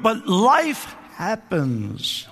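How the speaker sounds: very slow, stretched out; drawn-out punchline. Real, not acted. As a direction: let each word hang. Preacher's punchline slowly.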